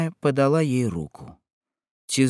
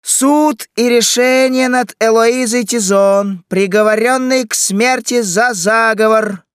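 loud, high, drawn-out proclamation; crier's shout; 10 semitones higher, 8 dB louder than usual. A narrator's reading. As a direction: announce the verdict like a street herald. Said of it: "На улице снова послышался крик". (протяжный крик глашатая)

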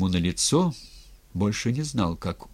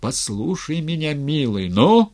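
higher plea bursting loud at the end; emotional plea; 6 semitones higher, 5 dB louder than usual. A narrator's reading. Friend begging the ataman to listen, emotional plea. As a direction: implore him, peaking on the last word. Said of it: "дрогнувшим голосом вскрикнул друг Матвей".

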